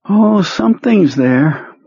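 high, slow, drained voice of a sick man; sick psychic Perlmutter. Acted. Ill telepath reporting to his boss, high and drained. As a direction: sound weak and washed out.

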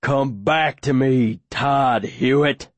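slow, drawn-out, ominously calm summons; calm menace. A narrator's reading. Bloodied Aaron summoning Todd, slow and almost calm. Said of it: "almost calmly this time, burbling through the blood".

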